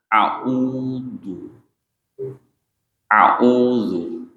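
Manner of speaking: a'udzu said with the lips rounding and moving on the dz; wrong lip movement. Shown as a demonstration. Teacher showing students the wrong lip movement, deliberately mispronounced. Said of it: "Itu salah".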